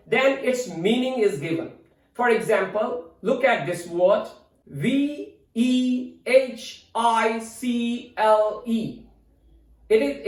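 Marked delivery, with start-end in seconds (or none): 4.7-9.0 slow, separate letter names; spelling out. Shown as a demonstration. Teacher spelling a word for learners, spelling out slowly. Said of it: "its spelling".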